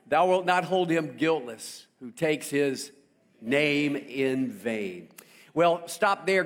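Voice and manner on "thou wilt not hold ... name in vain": slow, weighty verse, last words drawn out; solemn scripture reading. Acted. Preacher rereads commandment to church, slow and grave. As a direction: let the verse land heavy.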